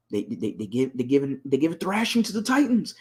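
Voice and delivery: tripping over words, repeating they; stuttering. Real, not acted. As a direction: stutter from excitement. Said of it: "I'm stuttering".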